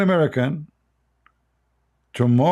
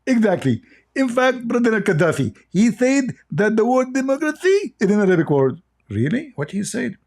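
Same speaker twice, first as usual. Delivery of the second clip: mock dialogue voices; 8 semitones higher than usual. Mock dialogue continues, high voices.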